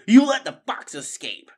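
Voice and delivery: loud, high accusation falling at the end; angry accusation. A narrator's reading. Officer rat berates his crony over the fox, accusing. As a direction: throw the blame at him with "you let the fox escape". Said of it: "Redtooth berated Fangburn".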